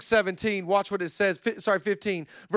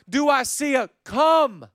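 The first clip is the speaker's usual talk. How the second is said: game-show host; 7 semitones higher, 5 dB louder, slower than usual. Retold or imitated. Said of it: "if I was one of them Family Feud".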